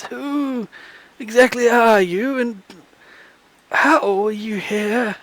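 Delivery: put-on high, soft, slow old man; frail old man voice. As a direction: squint and quaver like an old man. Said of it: "a frail voice".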